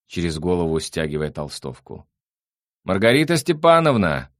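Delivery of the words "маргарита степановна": high, loud summons; calling from afar. A narrator's reading. Sandro summons the housekeeper from far off, calling loudly. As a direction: shout for the housekeeper across rooms. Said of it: "издалека послышался его голос".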